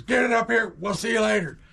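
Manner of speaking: high, loud holler; retold parting shout from far off; imitating shouting dad. Dad leaving kid on hill, mock shouted order. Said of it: "he says".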